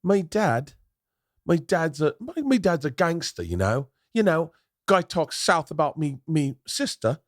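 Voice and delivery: high, hammed-up cockney threat; over-the-top cockney gangster boast; British gangster accent. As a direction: tell the gangster tale in a put-on London accent. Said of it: "can't do the accent".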